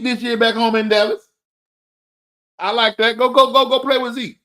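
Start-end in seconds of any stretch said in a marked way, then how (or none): 3.2-4.4 excited urging. Real, not acted. Urging Teddy toward Zeke.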